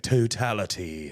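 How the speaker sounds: horrible, slow English accent; low, drawn-out fake English accent. Shown as a demonstration. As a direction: badly attempt an English accent. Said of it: "like, an English accent".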